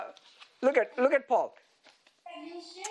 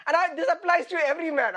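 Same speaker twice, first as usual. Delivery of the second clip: normal talking voice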